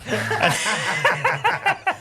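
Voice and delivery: high, loud burst of laughter; big laugh. Real, not acted. Teller cracking up at handing job to Mongo, loud laugh. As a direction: crack up loudly.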